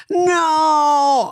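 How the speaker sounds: high, loud whiny no; mother refusing to let son leave, high loud wail; dramatic wail no. Retold or imitated. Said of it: "mom's dramatic no".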